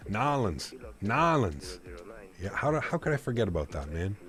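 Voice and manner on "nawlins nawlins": New Orleans squashed to "Nawlins"; local New Orleans pronunciation. Shown as a demonstration. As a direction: slur it into two syllables, "Nawlins". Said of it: "you have to say it like that".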